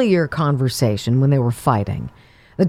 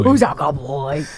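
talking to a dog; 6 semitones lower than usual. Acted.

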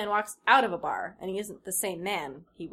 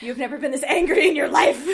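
loud, high hammed-up reading; dramatic anger; 7 semitones higher, 12 dB louder than usual. Reading the fanfic to his cohost, hammed-up rage. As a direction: ham up the fury for laughs.

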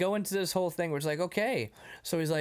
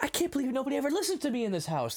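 put-on fast, high teenage whine; mock exasperation; 11 semitones higher, faster than usual. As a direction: complain like an unheard son. Son complains to parents, retold.

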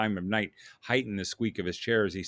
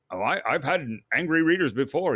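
higher, louder flustered protest; defensive editor; 5 semitones higher, 5 dB louder than usual. Acted. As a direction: stammer it out defensively. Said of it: "in defense of his accuser".

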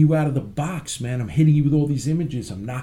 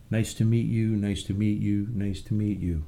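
sweet polite greeting; 6 semitones lower, 5 dB quieter than usual. Retold or imitated. Gentle self outside the ring.

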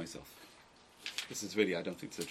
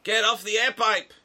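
punchline outburst; 11 semitones higher, 9 dB louder, slower than usual. Retold or imitated. Rabbi reads the joke's punchline.

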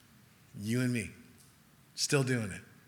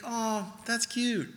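loving indulgence; 9 semitones higher than usual. Retold or imitated. Jesus amused by a clueless request, imitated with high loving indulgence. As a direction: coo it as if to an adorable child. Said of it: "Jesus just so lovingly".

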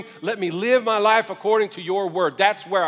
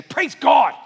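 louder, higher, fervent "Praise God"; meant it; 6 semitones higher, 8 dB louder than usual. Shown as a demonstration. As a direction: say "Praise God" like you mean it. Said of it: "Just say it like you mean it".

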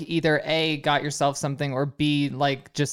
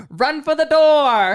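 high, loud, slow playful call; joking shout; 11 semitones higher, 7 dB louder, slower than usual. Retold or imitated. Boyfriend joking to his girlfriend on the podcast, loud playful call. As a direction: belt it out like a jokey command. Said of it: "I was just being funny".

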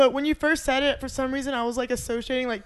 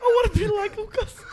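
laughing; 7 semitones higher than usual. Pretend. Echoing the kid quote laughing.

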